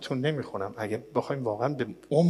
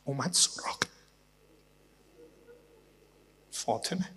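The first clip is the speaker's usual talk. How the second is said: hushed; 6 semitones higher, 8 dB quieter than usual. Acted. Hushed turn to Fatima's grief.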